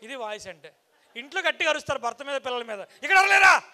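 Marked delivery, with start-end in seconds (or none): 2.8-3.6 high, very loud yell at the crowd; challenging shout. Real, not acted. Speaker goading those who yell at home, sudden loud shout. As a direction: shout to provoke a response.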